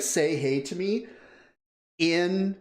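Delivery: normal talking voice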